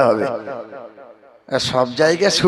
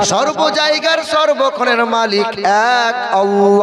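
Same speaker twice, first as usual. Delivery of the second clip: chanted, sing-song sermon, louder and slower; full-force preaching; 3 semitones higher, 3 dB louder, slower than usual. Acted. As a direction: preach it in a rising chant to the crowd.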